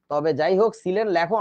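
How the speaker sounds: ordinary talk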